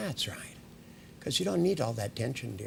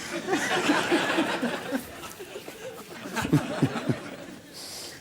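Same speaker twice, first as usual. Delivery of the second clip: laughing. Real, not acted. Laughs at subject's reaction.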